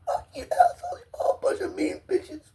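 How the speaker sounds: tearful whispered curse; bitter whisper. Real, not acted. Cursing everyone after the outburst, whispered. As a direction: spit it out under the breath.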